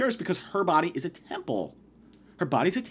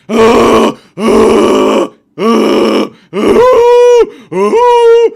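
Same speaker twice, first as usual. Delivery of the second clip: imitating the kid's loud staccato yelps from the stall; loud panicked yelps; 3 semitones higher, 16 dB louder, much slower than usual. Kid yelping in the bathroom stall, loud panicked ahs. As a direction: yell short panicked ahs. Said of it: "There's a kid in the bathroom just like".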